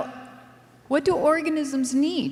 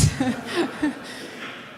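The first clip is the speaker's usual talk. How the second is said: breathless laugh; 5 dB quieter than usual. Real, not acted. Laughing at her own joke.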